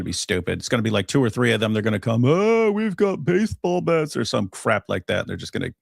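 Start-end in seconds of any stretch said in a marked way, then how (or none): 2.2-4.2 high, slow, hammed-up thug boast; mock tough-guy intruder. Mocking the attackers who storm in, in a high, slow thug boast. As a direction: sneer it like a cocky burglar.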